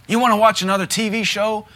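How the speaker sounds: high, loud teasing challenge; mock rebuke. Preacher taunting his flesh about TV, loud and high. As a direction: taunt your own cravings.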